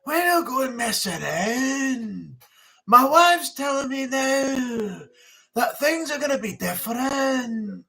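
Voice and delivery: put-on slow, stretched older Scottish voice; drawn-out Billy Connolly. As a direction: drag every word out lazily. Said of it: "his words are all drawn out".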